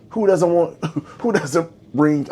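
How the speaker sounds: talking through hiccups; words broken by hiccups. Real, not acted. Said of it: "I'm sorry, I got the hiccups".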